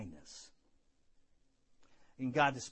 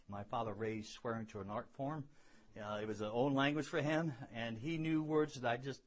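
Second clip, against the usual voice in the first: normal talking voice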